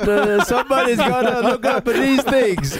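put-on high, hammy Italian accent. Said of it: "Never do an Italian accent ever again".

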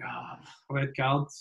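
quiet, slow, sheepish mumble; mumbled reply. Retold or imitated. Teammate sheepishly admitting to his manager. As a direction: mutter it low and slow like a caught-out player. Teammate voiced in a dressing-room story.

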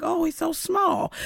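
slow, high amazed exclamation; surprised gasp. Retold or imitated. Stylist first seeing [name] in the door, slow high amazement.

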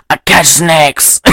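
slow, hammed-up fake Paraguayan accent; bad Paraguayan accent. Snake hunter telling his trade, in a bad Paraguayan accent. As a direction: drag it out in an awful Paraguayan accent. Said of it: "He's so awful at this accent".

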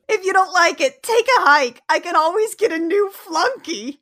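hammed-up diva telling her boyfriend to take a hike; snotty brush-off. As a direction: toss him aside like a diva. Jem insulting Rio.

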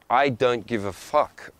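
slow, deliberate dismissal; unbothered retort. Acted. Traveller answering a critic who called him rude, slow indifference. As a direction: draw each word out, mock defiant. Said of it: "I'm like".

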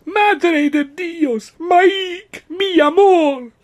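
very high, loud and drawn-out Spanish lament; hammed-up slow Spanish cries of despair; melodramatic Spanish wail. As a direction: wail the words like a soap-opera heroine in grief. Lucia fearing Mike is dead.

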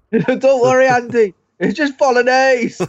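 high, laughing stoner voice; slow it down like a stoner. Retold or imitated. Imitating stoner character.

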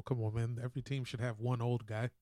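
normal talking voice

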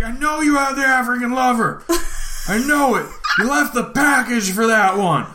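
high put-on insisting shout; mock yell. Clerk accusing the thief again, high mock shout. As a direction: press the accusation in a caricature voice. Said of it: "I was like".

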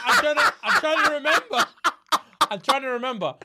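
high, laughing protest; laughing while explaining. Real, not acted. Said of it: "laughing defending himself".

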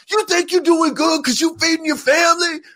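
full-tilt pumped-up preacher shout; very high-pitched, strained yell; Eric Thomas impression. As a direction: imitate a hip hop preacher screaming a challenge. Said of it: "He gets all pumped up".

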